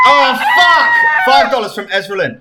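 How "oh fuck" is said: startled shout. Real, not acted. Startled by a donation alert.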